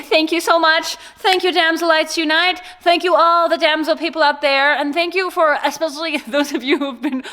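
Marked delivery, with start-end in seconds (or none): none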